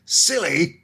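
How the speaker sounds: high, indignant "Silly?"; outraged. A narrator's reading. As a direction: spit back "Silly?" in outrage. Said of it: "exclaimed Filch, outraged".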